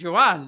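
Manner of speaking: "Juan" said loud and clipped as "Wan", the Barese way. Shown as a demonstration. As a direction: say "Juan" like the family did, not Giovanni. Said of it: "That's the way they".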